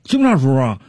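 high-pitched, stammering self-justification; comic vendor, indignant and high; aggrieved street vendor. Acted. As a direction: plead your case to the police in a high voice.

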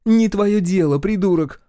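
high, loud dismissive retort; angry snap. A narrator's reading. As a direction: snap at him to mind his business. Woman snaps at nosy man.

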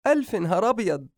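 high-pitched motherly rejoicing; delighted mother, pitched well up; overjoyed mother. A narrator's reading. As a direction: celebrate the news in a lifted, singing voice. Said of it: "عائشة".

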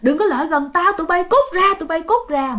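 high-pitched mock yell of warning; retold yelling. Possessed boy warns villagers not to come near, yelling. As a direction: act out a possessed boy yelling people away. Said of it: "cậu la lên".

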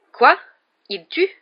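startled, rising questions; shocked exclamation. A narrator's reading. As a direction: exclaim it in alarmed disbelief. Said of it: "s'exclama Harry en renversant". (exclamation stupéfaite)

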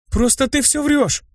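high, slowed accusation; indignant cry. A narrator's reading. Blonde chasing her friend, high indignant cry. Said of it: "крикнула блондинка".

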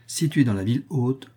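usual speaking voice

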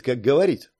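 plain reading voice

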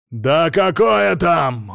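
loud drawn-out roar. A narrator's reading. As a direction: roar it, slow and furious. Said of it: "взревел Сила Игнатьевич".

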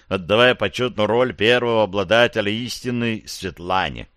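usual speaking voice

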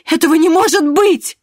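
very high, loud wounded cry; anguished cry. A narrator's reading. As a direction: cry out like a shot hare. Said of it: "вскрикнула, как подстреленный заяц".

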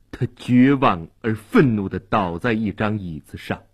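ordinary delivery

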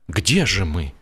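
plain reading voice

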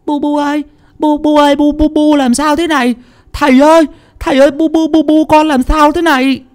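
high, loud, desperate calling for mother and father; a small boy begging his mother to wake; frightened child crying. A narrator's reading. Boy calls to his collapsed mother and father, frightened crying.

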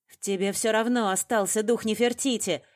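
forceful, menacing voice; threatening. A narrator's reading. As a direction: press the threat home forcefully. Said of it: "Голос её налился угрозой".

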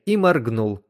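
usual speaking voice

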